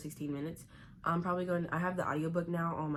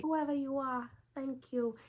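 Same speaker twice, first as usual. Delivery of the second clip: much higher, more theatrical Lois voice; dramatic Lois impression; 10 semitones higher, slower than usual. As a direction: ham up the gratitude, soaring high. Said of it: "but it's kinda dramatic".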